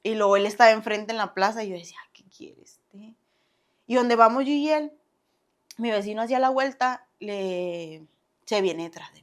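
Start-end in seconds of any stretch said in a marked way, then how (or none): 2.1-3.2 whispered thought, very soft and slow; barely voiced aside; muttered aside. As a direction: mutter it under your breath. Inner thought, muttered under breath.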